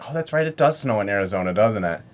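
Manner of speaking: normal talking voice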